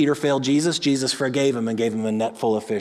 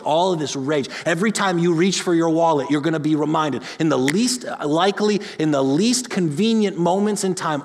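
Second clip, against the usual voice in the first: louder, higher, driving sermon build; preaching at full force; 3 semitones higher, 4 dB louder than usual. Acted. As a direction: hammer each "all this" home to the congregation.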